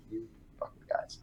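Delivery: barely voiced aside, very soft; whispered undertone of accusation; accusing undertone. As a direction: mutter it under your breath. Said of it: "there's that undertone of".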